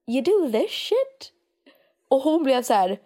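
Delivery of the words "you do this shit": higher and slow, in disbelief; incredulous. Retold or imitated. Retelling her reply to a mocking woman, slow and incredulous. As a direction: stretch it out, stunned. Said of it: "för jag var så här".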